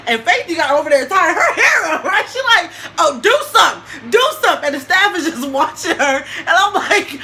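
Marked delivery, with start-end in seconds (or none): 3.0-4.7 urging shout. Retold or imitated. Friend urging her in fight.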